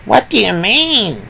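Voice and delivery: high, puzzled pig voice; a confused little pig asking; high confused piglet. Acted. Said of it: "asked another pig".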